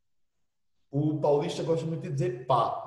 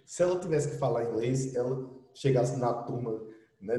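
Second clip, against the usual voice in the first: ordinary delivery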